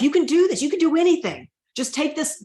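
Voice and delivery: high, pleading cry to God; begging God to bring him back, voice climbing high; desperate pleading. Retold or imitated. Widow retelling her prayer to God for her husband, high desperate pleading. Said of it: "begging and pleading with God".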